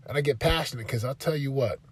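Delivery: ordinary talk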